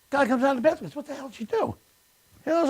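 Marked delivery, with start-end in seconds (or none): 1.0-1.8 high-pitched mock outrage; high, baffled joke voice; acted bewilderment. Acted injured man in joke.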